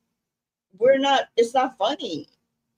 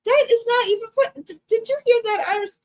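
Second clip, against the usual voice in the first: mock outrage; 9 semitones higher than usual. Mock outrage at her friend's joke.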